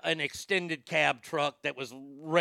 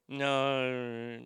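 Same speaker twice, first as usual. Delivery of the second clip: drawn-out, low-energy brush-off; uncaring clerk; 3 semitones lower than usual. Retold or imitated. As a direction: stretch it out like you couldn't care less. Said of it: "not caring, not listening".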